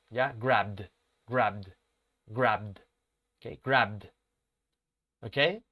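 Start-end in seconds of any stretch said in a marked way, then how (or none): 0.4-2.9 grabbed ending in a voiced D sound; final D sound. Shown as a demonstration. Teacher modelling grabbed for students, voiced D ending. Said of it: "se va a pronunciar con D". 3.6-4.1 grabbed ending in a voiced D sound; final D sound. Shown as a demonstration. Teacher modelling grabbed for students, voiced D ending. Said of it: "se va a pronunciar con D".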